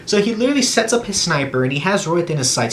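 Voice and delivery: ordinary talk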